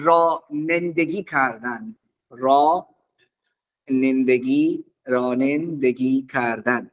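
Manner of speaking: رانندگی stretched out slowly, syllable by syllable; slow dictation. Shown as a demonstration. Teacher dictating the Persian word to the class, very slow. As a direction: spell out رانندگی کردن slowly so students can copy. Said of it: "driving میشه".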